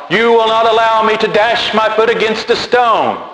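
high, sustained cry without much volume; mock yell at God. Falling man defiantly addressing God mid-air, mock yell. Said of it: "yell at God".

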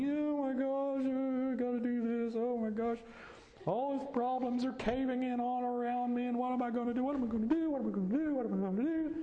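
high, frantic panic, near a shriek; mock the worried mind, high and fast; panicked shrieking. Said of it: "Quit shrieking in your mind".

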